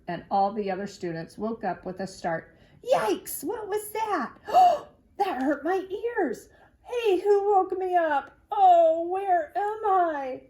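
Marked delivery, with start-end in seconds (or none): 2.8-10.4 high, loud cries of alarm; hammed-up shrieking classmates; startled children. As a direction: jolt awake yelling with a gasp. Students startled awake.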